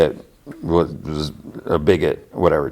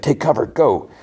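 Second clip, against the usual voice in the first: higher-pitched mock call to take cover; retold urgent order, pitched up; urgent warning; 7 semitones higher than usual. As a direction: raise the pitch for an acted warning. Urgent warning to everyone on path.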